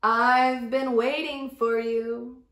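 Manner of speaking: slow, low and mysterious; slow wise creature greeting; deep octopus voice. Acted. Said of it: "said the octopus with a deep voice".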